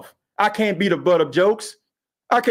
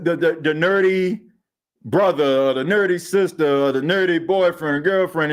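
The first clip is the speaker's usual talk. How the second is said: ordinary delivery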